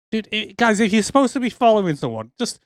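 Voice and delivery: exasperated mock plea; mock advice to the goons.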